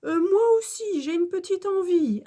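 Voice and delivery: put-on high, insistent child's voice; Alfred insistant. A narrator's reading. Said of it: "insiste Alfred". (petit élève qui insiste)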